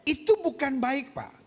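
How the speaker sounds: usual speaking voice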